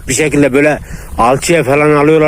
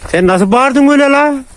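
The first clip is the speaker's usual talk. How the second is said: much higher, playful pet voice; 12 semitones higher than usual. Real, not acted. Owner teasing the yelping puppy, high playful pet talk. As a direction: tease the little dog in a high squeaky voice.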